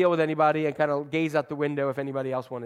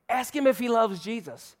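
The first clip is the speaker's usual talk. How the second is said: put-on little girl voice; 5 semitones higher than usual. Girl voiced in retold story.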